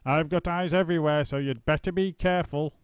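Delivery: put-on mildly cackling old wife; gloating warning. A narrator's reading. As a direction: tease him with the warning. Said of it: "cackled Missus Twit".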